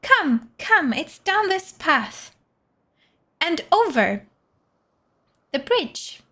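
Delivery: beckoning game character. Acted. Voicing a game character leading the way.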